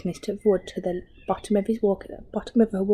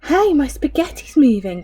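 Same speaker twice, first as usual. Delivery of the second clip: raised startled exclamation; mild surprised cry; 6 semitones higher, 4 dB louder than usual. A narrator's reading. Husband finding his spaghetti moving, startled cry. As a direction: react as the plate wriggles. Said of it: "cried Mister Twit".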